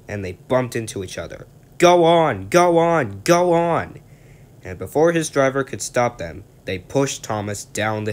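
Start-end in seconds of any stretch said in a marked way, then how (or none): 1.8-4.0 high, loud mischievous truck chant; egging on. Acted. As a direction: shove him on like naughty trucks. Trucks pushing Thomas downhill.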